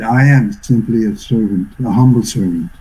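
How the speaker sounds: low-pitched, gentle humility; retold meekly, pitched well down; humble, meek. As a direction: imitate a sweet, meek confession from deep inside the heart. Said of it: "in a very humble, meek way".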